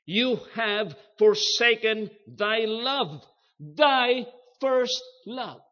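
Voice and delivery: loud, high, drawn-out stress on "forsaken thy love"; emphatic repetition. Shown as a demonstration. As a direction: pound out "thy first love" slowly, word by word. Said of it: "That's the emphasis, the repetition".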